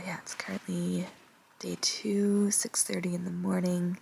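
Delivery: soft early-morning whisper. Real, not acted. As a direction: keep it to a whisper. Whispering in tent at dawn.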